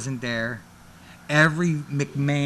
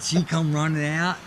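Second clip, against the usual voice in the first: normal talking voice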